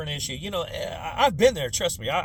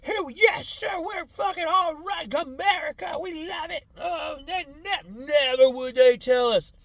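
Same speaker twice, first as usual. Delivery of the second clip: much higher, loud mock patriotic yelling; gung-ho patriot; 11 semitones higher, 4 dB louder, slower than usual. Mocking the subdued generation, high patriotic yell. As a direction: bellow like an obedient flag-waver. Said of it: "to just be like".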